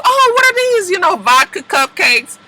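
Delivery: very high, condescending sing-song; snooty mock voice. Mocking Blair sneering at Kelly's cupcakes, high and snooty. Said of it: "Blair comes up and says".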